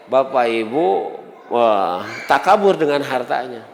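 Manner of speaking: ordinary talk, nothing marked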